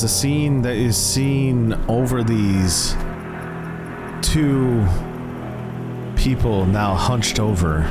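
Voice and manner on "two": normal talking voice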